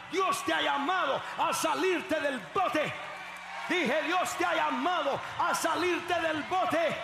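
high, loud, driving sermon shout; rally the church at the top of your voice; full-force preaching. Real, not acted. Preacher urging congregation to face problems, impassioned shouting.

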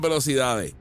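ordinary talk

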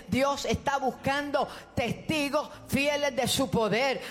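ordinary delivery

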